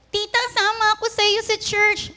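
put-on high, eager kid asking tita to come along; pleading child. A child begging tita to take them to church, high and eager. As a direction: beg like a little kid who wants to tag along.

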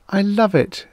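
high-pitched, delighted female character; happy. A narrator's reading. Airy creature delighting in a new world, happy. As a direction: gush it happily, way up high. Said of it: "she said happily".

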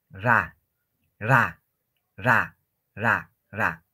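low RA with a brief untrilled R; correct soft R. Shown as a demonstration. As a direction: model RA lightly, no roll. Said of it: "No es ra".

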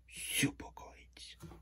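hushed, drawn-out whisper; savouring whisper. Acted. Loomis hearing about cranberry pie, savouring whisper. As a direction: whisper it slowly, relishing the thought.

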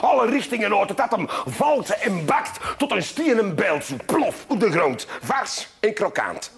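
ordinary talk, nothing marked

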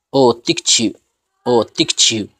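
tic chi with the final U barely heard; soft fading U. Shown as a demonstration. Teacher showing students tic chi with a soft, fading U. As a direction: let the U melt away after chi. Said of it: "podemos decirlo muy suavecito".

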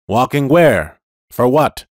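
loud insistent questions; loud demand. Acted. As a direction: press him hard. Police car demands.

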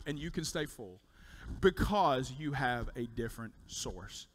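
ordinary talk

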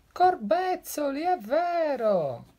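high, loud astonished cry; high startled snake voice; snake exclaiming. Acted. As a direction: gasp it out in amazement. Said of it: "esclamò la biscia".

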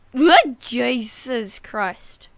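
sudden loud startled yelp. Real, not acted. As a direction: caught off guard by a slip. Almost fell off.

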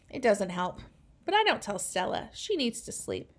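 usual speaking voice

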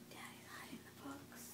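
hushed, secretive whisper. Real, not acted. Whispered aside to child.

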